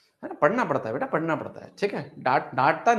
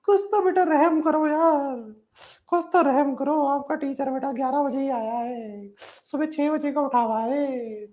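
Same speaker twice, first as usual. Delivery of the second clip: high, whiny plea for mercy; hammed-up tired teacher pleading; mock plea; 9 semitones higher than usual. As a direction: beg the class in a put-on voice, half joking. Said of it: "teacher pleading about himself".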